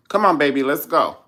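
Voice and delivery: loud, high hurry-up call; loud exit call. Acted. Leaving the church scuffle, a loud call to her partner.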